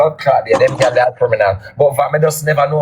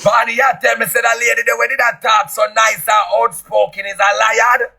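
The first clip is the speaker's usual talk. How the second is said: incredulous shout; 8 semitones higher than usual. Real, not acted. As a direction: yell it out in disbelief. Incredulous outburst at Apple claim.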